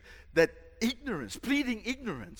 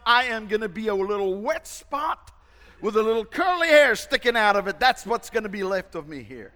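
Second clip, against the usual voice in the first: comic inner voice; 4 semitones higher, 8 dB louder, faster than usual. Retold or imitated. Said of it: "thinking to himself".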